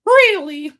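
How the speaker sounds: very high, loud puppet scoff. A narrator's reading. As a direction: scoff like a cheeky boy. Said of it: "said the puppet to himself".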